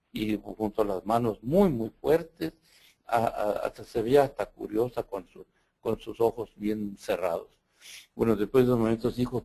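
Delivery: usual speaking voice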